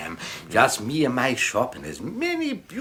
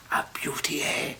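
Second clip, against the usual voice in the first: hushed awe; 5 dB quieter than usual. Acted. Admiring album in hushed tones.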